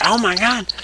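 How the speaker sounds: high-pitched mock dismay; imitated shock. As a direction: exclaim in put-on disbelief. Acting Austin hit with beer.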